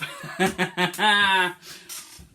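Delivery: breathless real laugh. Real, not acted. Teacher laughing after the body warm-up. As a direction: laugh off the effort.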